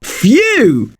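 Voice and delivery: high, drawn-out "phew" of relief; relieved phew. Pretend. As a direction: let out a big phew after hard work. Relief after long explanation.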